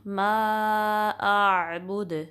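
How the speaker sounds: मा आबुद slow, with the dal bounced at the end; qalqala on dal. Shown as a demonstration. Teacher demonstrating qalqala, slow and clear. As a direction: let the dal of आबुद echo back. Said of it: "दाल पे कलकला करेंगे".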